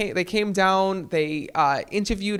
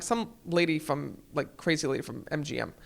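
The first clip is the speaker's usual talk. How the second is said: dismissive mutter; 5 semitones lower, 9 dB quieter than usual. Retold or imitated. Brushing off caller to Alexa.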